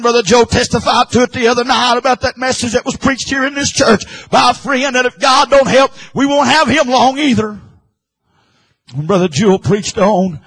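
high, loud, driving sermon delivery; full-force preaching. Real, not acted. Preacher stirring up the congregation. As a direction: preach it hard with fire.

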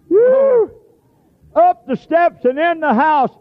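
high, loud whoop then slow cry; joyful whoop. Acted. Preacher rejoicing at the healed man's climb, high joyful whoop. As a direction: whoop with delight.

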